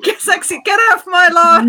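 high, loud get-off-my-lawn shout; old man yelling. Pretend. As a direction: yell like a cranky old codger.

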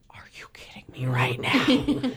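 whispered disbelief, low and breath-only; stunned whisper. Retold or imitated. Teen fan offered a meeting with her idol, stunned whisper. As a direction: whisper it as if too overwhelmed to speak.

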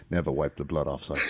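low, hushed movie-hero impression; Liam Neeson. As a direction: say it low and soft like a solemn screen father. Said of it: "Liam Neeson being like".

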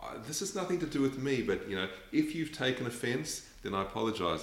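tossed-off mock apology ending "then I apologise"; mock non-apology. Showing how people distance themselves, a quick mocking apology. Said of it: "it's like".

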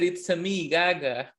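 mock Italian "It's a me" like Mario; Mario-style catchphrase; Mario impression. Joking that the actress sounds like Mario, Mario voice. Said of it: "And she said".

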